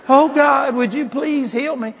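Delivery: high, loud-starting imitation of begging; mock pitiful prayer. Preacher mocking Christians praying for healing, mock pitiful. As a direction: beg God in a hammed-up plea.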